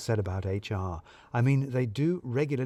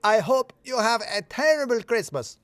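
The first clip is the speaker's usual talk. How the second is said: put-on much higher accented parting shot; shouted back; 11 semitones higher, 3 dB louder than usual. A narrator's reading. Radu shouts back at the team leaving, higher accented voice. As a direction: fling it over the shoulder. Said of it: "stopping only to shout back".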